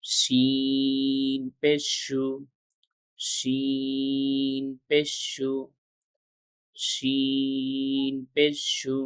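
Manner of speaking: very slow, each syllable drawn out like a chant; slow recitation drill. Shown as a demonstration. Teacher reciting Arabic letters slowly for students to repeat. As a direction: stretch every letter name slowly for the learners.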